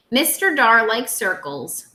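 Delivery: ordinary talk, nothing marked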